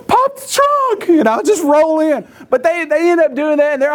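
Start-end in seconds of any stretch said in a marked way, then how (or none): 0.1-2.3 gasping, high, strained plea; comically winded runner; mock out of breath. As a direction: gasp first, then squeeze the words out slowly. Plays someone winded running round car.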